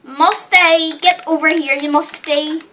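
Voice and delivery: loud, high call for the horse. Real, not acted. Boy calls for his lost horse Musty, loud. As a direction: holler for the horse across the field.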